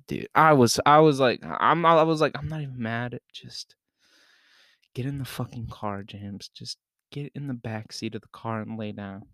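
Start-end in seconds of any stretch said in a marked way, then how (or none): 2.4-9.2 much softer, resigned retold order; quiet resignation. Driver retells telling drunk friend to get in, quietly resigned.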